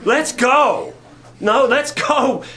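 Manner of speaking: rally them, fired up; excited disciple cheering God on; eager campaigning. Retold or imitated. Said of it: "campaigning like".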